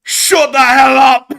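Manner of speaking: huge high geezer shout; Brexit geezer marmot. Pretend. Marmot cutting off the denial, shouting Brexit geezer. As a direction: bellow it slowly as a cartoon Brexit geezer. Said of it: "the accent of the marmot is that of a Brexit geezer".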